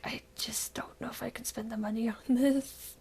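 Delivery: hushed, half-laughing whisper. Retold or imitated. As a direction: whisper the doubt to yourself. Quoting own hesitation, whispered.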